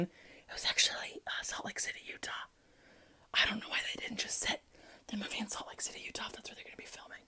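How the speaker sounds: hushed, fully whispered; whispered aside. Real, not acted. Podcaster slips in the real filming location, whispered aside. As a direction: confide it under your breath in a whisper.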